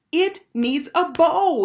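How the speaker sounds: high, loud, drawn-out wail. A narrator's reading. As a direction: wail it like a thwarted child. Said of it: "he wailed".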